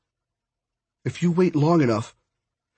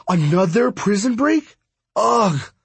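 high-pitched disbelief, then a groaned ugh; exasperated; 8 semitones higher, 4 dB louder, slower than usual. Acted. Friend hearing of another prison break, exasperated. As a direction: balk at the plan, higher and exasperated. Exasperated reaction to dad's jailbreak plan.

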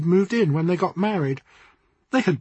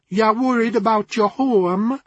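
put-on higher Jamaican accent, knowing; 5 semitones higher, 4 dB louder than usual. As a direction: Jamaican fortune-teller revealing a secret. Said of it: "in a rich Jamaican accent".

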